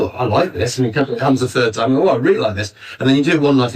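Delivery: normal talking voice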